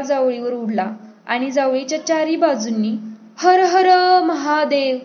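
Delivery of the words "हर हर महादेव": loud, high, drawn-out war cry; battle cry. A narrator's reading. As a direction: stretch it out like a rallying call to battle. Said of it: "ची अरोळी उठली".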